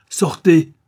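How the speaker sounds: loud, sharp order to leave; angry shout. Acted. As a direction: throw him out with one word. Adeline orders the visitor out.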